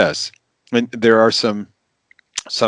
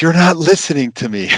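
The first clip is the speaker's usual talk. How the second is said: higher-pitched mock exasperation; hammed-up wife protesting she is not heard; acted complaint; 8 semitones higher, 4 dB louder than usual. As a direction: complain in a raised, aggrieved voice. Acts out the sketch wife's complaint.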